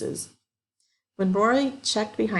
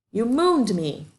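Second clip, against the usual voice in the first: higher, louder accusation; indignant; 5 semitones higher, 4 dB louder, slower than usual. A narrator's reading. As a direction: throw the accusation at him. Dana accusing.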